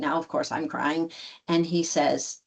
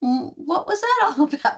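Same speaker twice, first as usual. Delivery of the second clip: high-pitched retold question; tentative high-voiced query; curious questioning; 11 semitones higher than usual. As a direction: ask lightly, pitched well up.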